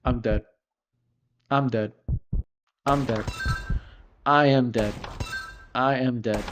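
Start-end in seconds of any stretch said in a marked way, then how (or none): none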